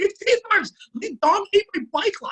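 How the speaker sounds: high, loud re-enacted outburst; outraged insistence. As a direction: yell the accusation in exasperated disbelief. Acted outraged argument with mother.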